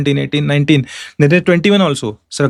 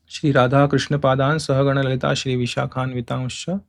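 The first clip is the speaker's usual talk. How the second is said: soft, low run-through without pauses; quiet recall; 4 semitones lower, 6 dB quieter than usual. Real, not acted. Teacher checks the last line, reciting softly. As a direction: recite it lightly to yourself.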